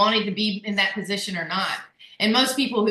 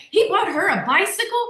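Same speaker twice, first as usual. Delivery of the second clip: high, incredulous busybody questioning; mock disbelief; 7 semitones higher than usual. Critics fussing over a gift to her daughter, mock disbelief. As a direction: play shocked at the scandal, pitched up.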